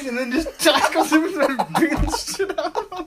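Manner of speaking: high, loud words breaking into laughter; laughing hard. Real, not acted. Friend reliving the tackle, laughing hard. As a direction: barely get it out for laughing.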